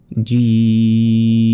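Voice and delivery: জিম with the ee held very long, closing on m; sustained recitation-style "jeeeeem" for জিম; four-second stretch. Shown as a demonstration. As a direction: draw out জিম slowly on one level pitch. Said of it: "চার second টেনে পড়তে হবে".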